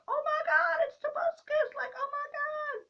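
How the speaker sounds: squealing fan meltdown; starstruck squeal. Real, not acted. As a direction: lose it at seeing your celebrity crush. Spots her crush Tobuscus.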